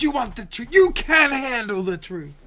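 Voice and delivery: loud, high furious courtroom shout; Few Good Men shout. Retold or imitated. Quoting the film to viewers, a loud furious courtroom shout. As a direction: bellow it at the witness stand. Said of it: "That's A Few Good Men".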